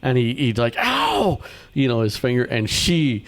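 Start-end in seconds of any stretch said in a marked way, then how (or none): 0.8-1.4 a sudden high yelp of pain; mock yelp. Teacher retelling the trap snapping, high mock yelp. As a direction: yelp like you caught your finger. Teacher voiced hurting finger in story.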